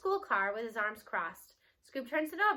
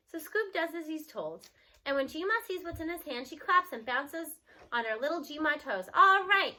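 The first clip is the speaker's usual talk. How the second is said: ordinary delivery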